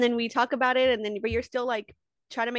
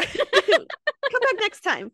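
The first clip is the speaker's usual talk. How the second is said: laughing; 11 semitones higher, 7 dB louder, much slower than usual. Real, not acted. Laughing sign-off.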